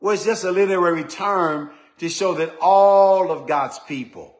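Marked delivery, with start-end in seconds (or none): 2.6-3.3 ALL stretched out long and strong; emphasis on ALL. Shown as a demonstration. Preacher showing all of God's people are meant, emphatic. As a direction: hold "all" for weight. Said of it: "to emphasize".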